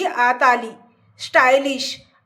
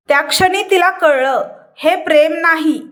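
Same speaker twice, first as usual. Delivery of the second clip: usual speaking voice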